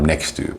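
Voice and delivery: "next to" as almost one word, one t; linked speech. Shown as a demonstration. Teacher showing connected speech, linked "next to". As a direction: drop the first t and join "next to". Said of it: "almost like one word".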